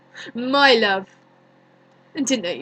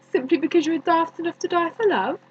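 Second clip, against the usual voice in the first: high, quick, incredulous scoff; mocking question in a rush; scornful; 6 semitones higher, 3 dB louder, faster than usual. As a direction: scoff at the very idea, pitched up. Scoffs at Juliet's death for love.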